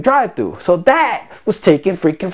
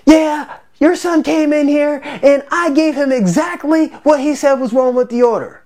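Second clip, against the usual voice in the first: mock raised voice, pitched up; imitating her snapping back, higher; the manager raising her voice at the customer, pitched-up mock snap; 8 semitones higher than usual. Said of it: "immediately raises her voice on me".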